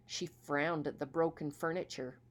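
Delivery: usual speaking voice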